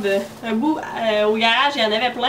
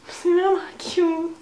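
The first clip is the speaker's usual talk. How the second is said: cooing; 7 semitones higher, slower than usual. Real, not acted. Cooing over milkshake sharpener.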